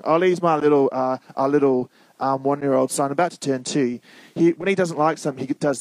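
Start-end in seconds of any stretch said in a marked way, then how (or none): none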